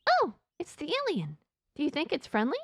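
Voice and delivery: high put-on character voice; Ella. Ella asks Johnny about the alien, high voice. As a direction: pitch it high, asking with wonder.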